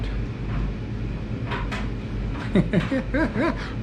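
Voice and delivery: breathy delighted giggle; high airy laugh. Real, not acted. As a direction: laugh softly with glee. Delight at camp.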